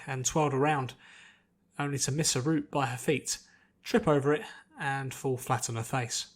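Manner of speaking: usual speaking voice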